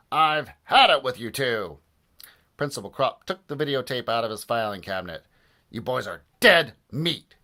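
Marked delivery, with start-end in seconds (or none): 0.1-1.8 loud, fed-up principal; angry shout. A narrator's reading. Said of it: "shouted Mister Krupp". 5.7-7.4 loud, slow, menacing sneer; sneering threat. A narrator's reading. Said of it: "he sneered".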